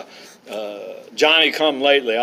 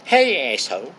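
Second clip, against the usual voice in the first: put-on toddler's southern drawl. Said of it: "she said it with a southern drawl".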